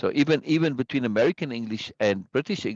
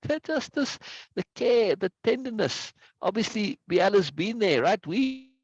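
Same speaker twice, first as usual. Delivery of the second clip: real high-pitched, tearful overwhelm; emotional, choking up; 11 semitones higher than usual. Real, not acted. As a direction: let your voice rise high as emotion takes over mid-sentence. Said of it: "It's a very emotional moment for me".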